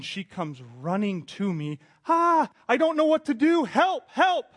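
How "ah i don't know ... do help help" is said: put-on much higher and louder, panicked child crying for help; a small daughter in a flooding-toilet panic; high, loud kid's voice calling help; panicked little girl.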